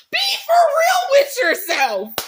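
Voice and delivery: forceful shout at a friend. Real, not acted. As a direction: yell it as a wake-up call. Repeated plea shout.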